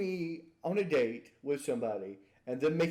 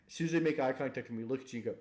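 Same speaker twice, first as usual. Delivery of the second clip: usual speaking voice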